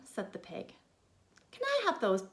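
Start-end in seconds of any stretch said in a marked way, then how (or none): 1.5-2.3 higher, louder pig voice; squeaky little pig asking; high piggy request. Acted. Third pig asks for bricks.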